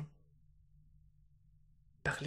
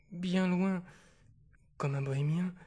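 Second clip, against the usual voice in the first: rising fervour; 3 semitones higher, 7 dB louder than usual. Acted. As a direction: let the longing burst out. Climax of the poem. (élan plus fort sur « bien loin »)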